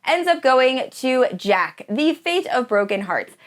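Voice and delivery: ordinary delivery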